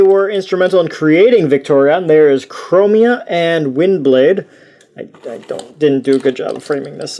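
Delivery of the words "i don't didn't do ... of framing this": usual speaking voice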